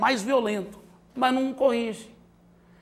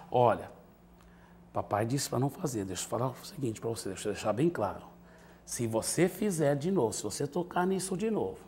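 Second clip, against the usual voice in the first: much lower and softer, steady firm words; calm quiet warning; 7 semitones lower, 8 dB quieter than usual. Shown as a demonstration. Father calmly warning son about the phone, quiet and firm. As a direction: lay down the rule calmly, no shouting. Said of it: "fala igual eu acabei de fazer".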